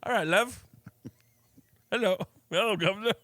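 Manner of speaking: hammy London charm; English accent. Pretend. Asked to do an English accent, he hams it up. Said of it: "do an English accent".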